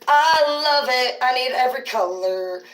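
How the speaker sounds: high, loud gushing over the shirt. Acted. A fan gushing over a new shirt, high and loud. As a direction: squeal how much you love the shirt.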